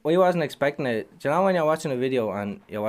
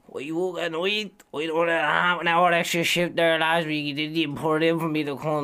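higher whiny coworker voice; mock coworker complaint; 5 semitones higher than usual. To his mate, imitating a moaning coworker, higher and whiny. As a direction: mimic a moaning site worker.

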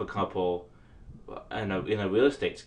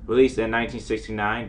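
ordinary talk, nothing marked

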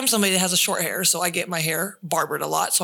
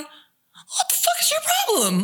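put-on bratty kid whine. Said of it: "The parents come around".

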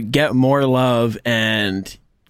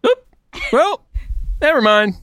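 mock casual shrug; 13 semitones higher than usual. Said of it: "he goes".

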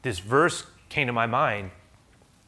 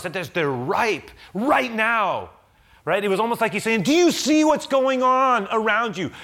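full-force preaching; 11 semitones higher, 8 dB louder than usual. Acted. Preacher voicing Jesus to the congregation, preaching at full force. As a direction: lift it up like a revival sermon climax.

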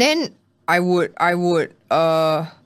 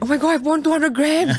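high, hammed-up shock at the scale; playful mock panic, pitched high; mock alarm; 10 semitones higher than usual. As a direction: gasp it out like it's a disaster.

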